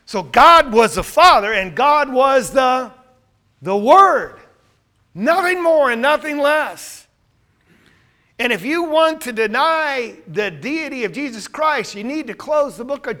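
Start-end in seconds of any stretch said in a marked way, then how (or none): 0.0-11.0 loud, forceful declaration; raised voice, hammering the key words; emphatic preaching. Real, not acted. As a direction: drive each phrase home at full voice. Preacher driving home his point.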